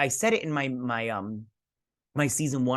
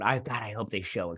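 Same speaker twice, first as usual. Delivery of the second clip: ordinary delivery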